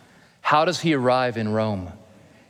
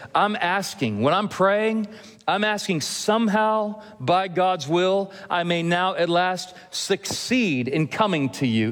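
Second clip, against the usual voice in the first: raised, earnest reading of Paul's words; scripture reading; 6 semitones higher than usual. Acted. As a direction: read the verse as Paul pleading. Reads Paul's prayer from Romans.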